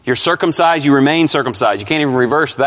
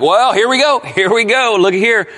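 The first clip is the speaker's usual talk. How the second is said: mock eager misreader; 5 semitones higher than usual. Preacher imitating people misreading the verse, mock eagerness. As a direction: pounce on a supposed loophole, pitched up. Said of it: "that's the big mistake people make".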